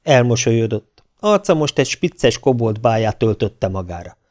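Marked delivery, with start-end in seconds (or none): none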